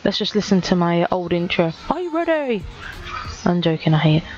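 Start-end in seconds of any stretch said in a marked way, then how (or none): 1.9-2.6 hyped announcer. Acted. Announcing his old intro.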